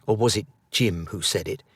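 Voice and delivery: ordinary delivery, nothing marked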